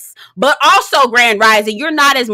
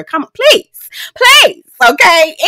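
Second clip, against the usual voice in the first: exasperated plea; 7 semitones higher, 6 dB louder, slower than usual. Pretend. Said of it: "pleading mock exasperation".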